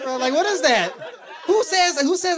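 ordinary talk, nothing marked

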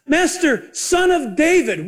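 high imitated plea, drawn out at the end; retold crying out. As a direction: call out as a woman appealing to her master. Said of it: "cried out to him".

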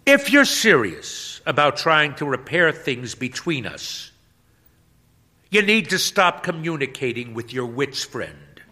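high, loud ultimatum; wife's demand. Acted. Wife at the door giving her husband an ultimatum, loud. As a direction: lay down terms through the door. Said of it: "sticks her head inside".

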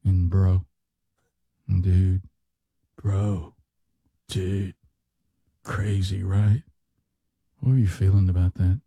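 lazy bro podcast talk; imitating a sleepy podcast host; mocking podcast dude. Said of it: "they're always talking like this right here".

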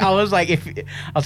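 ordinary delivery

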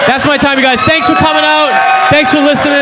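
high, projected thanks to the crowd; stage sign-off. Real, not acted. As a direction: call out over the applause. Signs off over cheering crowd.

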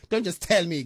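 laughing through the words; laughing plea. Real, not acted. Host teases a listener about the food, laughing. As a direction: giggle while demanding the food.

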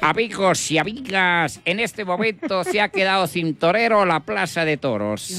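announcer asking the crowd for a new bullfighter; bullring announcer. Acted. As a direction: announce it to the stands. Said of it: "el animador dio".

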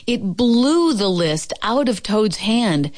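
usual speaking voice

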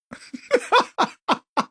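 high laugh. Real, not acted. Laugh after mockery.

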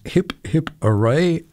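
ordinary talk

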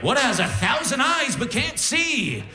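slow, high, shouted riddle; hammy riddle comic. Pretend. Imagined comic shouting a riddle at the audience, high and slow. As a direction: ham it up like a cheesy stage riddler. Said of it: "being like".

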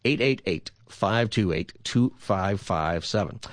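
digits spaced out for listeners; deliberate, measured phone-number read. Shown as a demonstration. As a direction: give the number slowly, group by group. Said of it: "I'll say it slower".

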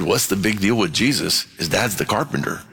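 usual speaking voice

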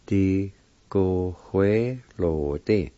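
"Di, go, hue, lo, di" in separated syllables. Shown as a demonstration. Teacher repeating the word for pencil, syllable by syllable. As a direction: sound out each part deliberately. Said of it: "It's pronounced like this".

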